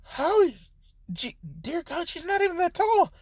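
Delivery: high, breathless raving; swooning in overwhelmed wonder; high gushing disbelief; ecstatic awe. Acted. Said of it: "Naruto's just like".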